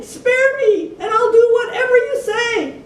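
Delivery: very high, loud pleading; imploring. A narrator's reading. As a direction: beg the big lion for mercy. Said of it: "implored the king".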